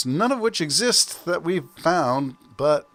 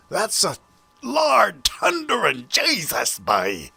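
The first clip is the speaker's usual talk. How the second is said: high, drawn-out Newfoundland exclamation; Newfoundland accent; 6 semitones higher, slower than usual. Acted. Podcaster reacting to a thunderstorm, best Newfoundland accent. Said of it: "my best Newfoundland accent for the thunderstorm".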